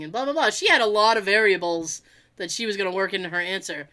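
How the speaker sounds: ordinary talk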